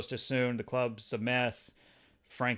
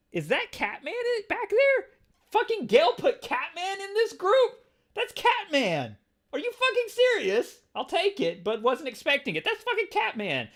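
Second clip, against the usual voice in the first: high, loud incredulous squeal; real astonishment; 6 dB louder than usual. Real, not acted. Reader spotting Catman in the group, squealing in disbelief. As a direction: blurt it out in shrieking disbelief.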